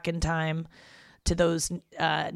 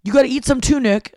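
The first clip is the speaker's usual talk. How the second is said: higher, louder mock dad; 7 semitones higher, 8 dB louder than usual. Dad telling Nick to eat some too, higher mock dad voice. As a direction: do your dad urging you. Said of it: "my dad is like".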